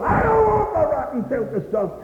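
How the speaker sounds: high shouting preacher's denunciation; full-force preaching. Real, not acted. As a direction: thunder it against sin.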